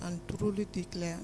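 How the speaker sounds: barely audible oath; soft, hard to hear; too quiet. Real, not acted. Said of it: "Can you speak louder".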